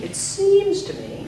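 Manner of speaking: slow, high "seems" held long; high, slow drill delivery; lengthened stressed word. Shown as a demonstration. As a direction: draw out "seems" in a model phrase. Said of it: "the last word gets longer".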